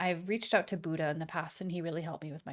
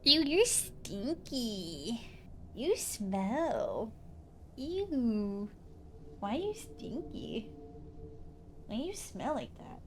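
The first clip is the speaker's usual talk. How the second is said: higher, drawn-out cooing at a pet; pet baby talk; 4 semitones higher, much slower than usual. Pretend. Streamer teasing her stinky cat, cooing baby talk.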